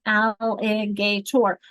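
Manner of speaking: Alligator stretched slowly as al-li-ga-tor; slow teacher demo of Alligator in four beats; counted syllables. Shown as a demonstration. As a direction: drag out each syllable of Alligator. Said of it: "draw a dash for each one".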